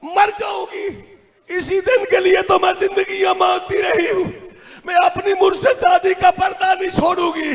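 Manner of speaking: high, crying shout of defiance; anguished. Retold or imitated. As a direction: wail out a desperate refusal. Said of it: "तड़प के कहती है".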